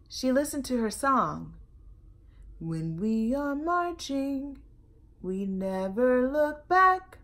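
put-on lively, loud read-aloud for children; storytelling read-aloud. A narrator's reading. Librarian reading a picture book to kids, lively.

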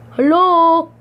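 loud, high "hello?"; calling out. Real, not acted. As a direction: call out for someone absent. Calling out to Cat God.

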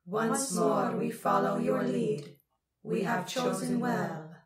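loud, slow, echoing crone chorus; combined crone voices. A narrator's reading. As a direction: proclaim it slowly as many crones at once. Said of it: "in the strange combination voice of the masked crones".

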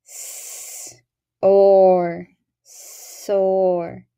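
S, or, soar: each sound apart, then blended; sounding out words. Shown as a demonstration. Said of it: "Listen as I blend the word soar".

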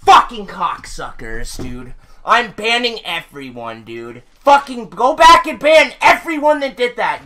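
ordinary delivery

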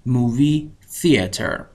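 movie theater said very slowly and clearly; slow model phrase. Shown as a demonstration. Teacher giving the American word for cinema, slow. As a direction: drag each syllable for learners.

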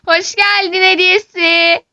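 high, slow, laughing gloat. Acted. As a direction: laugh it out smugly. (gülerek alaycı)

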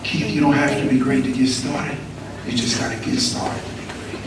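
soft, low teacher's counsel; quiet. Retold or imitated. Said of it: "He said".

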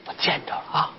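hushed, confidential whisper; barely voiced, for his ears only; secretive whisper. Secret message passed on.

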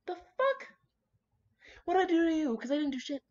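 high squeaky outraged what-the; mock shocked squeal; indignant disbelief. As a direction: act wronged by your own glasses. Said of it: "and you're like".

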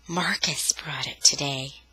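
hushed, unvoiced admission; whispered confession. Sister naming the soldier to her brother, whispered. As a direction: whisper it, half afraid.

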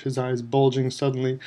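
plain reading voice

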